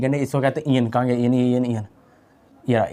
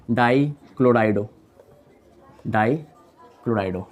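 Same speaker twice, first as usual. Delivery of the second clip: drawn-out dictation pace; slow dictation; 3 semitones lower, much slower than usual. Shown as a demonstration. Teacher writing the name on the board for students: slow dictation. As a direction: spell the name out slowly while writing.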